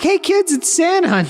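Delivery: put-on high, delighted call to the kids; excited greeting. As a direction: announce Santa to the children with glee. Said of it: "They'd probably be like".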